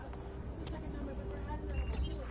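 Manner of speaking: nervous first-timer rushing the words. Retold or imitated. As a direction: rattle it off quickly. Retold nervous self.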